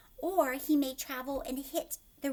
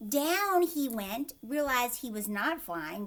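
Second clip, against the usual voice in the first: louder and slow storybook line; dramatic reading; 5 dB louder than usual. A narrator's reading. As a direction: let it drop slowly.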